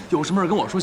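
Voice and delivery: normal talking voice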